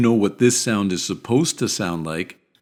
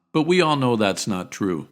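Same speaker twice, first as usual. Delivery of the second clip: ordinary talk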